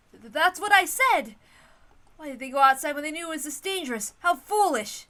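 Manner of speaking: loud, high, scolding anger; indignant outburst. Acted. Natsuhi blaming the reckless group, loud and angry. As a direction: snap it out in indignation. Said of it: "Wait, I'm sorry. That's probably Natsuhi's line".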